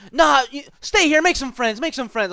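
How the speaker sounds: high, loud hammed-up party friend; mock pushy partygoer. Friends pressing the narrator to stay at the party, high mock pleading. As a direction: play the friend begging you to stay.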